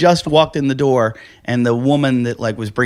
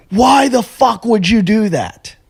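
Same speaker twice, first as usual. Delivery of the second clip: slow exasperated demand, pitched up high; angry club owner; 8 semitones higher, slower than usual. Retold or imitated. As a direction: drag out each word in disbelief. Club owner berates him outside.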